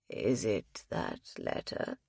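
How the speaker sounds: put-on a low, hushed old man's voice; an ailing husband murmuring to his wife; frail old man. Ailing husband reassures his wife, low frail old voice. Frail elderly Ralph speaks.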